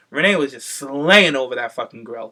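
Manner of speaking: usual speaking voice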